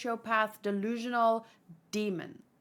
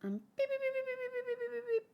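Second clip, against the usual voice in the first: high, soft electronic beeps; quick run of high-pitched beeps; imitated beeping; 12 semitones higher, 7 dB quieter, faster than usual. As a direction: imitate a rapid machine beep with your voice. Imitates a machine beeping in story.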